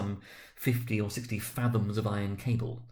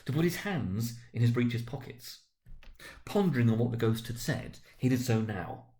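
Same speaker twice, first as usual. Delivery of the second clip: plain reading voice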